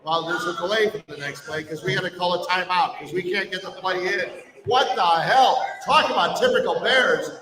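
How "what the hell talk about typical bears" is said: exasperated outburst. Real, not acted. Exasperated reaction to Bears blunder.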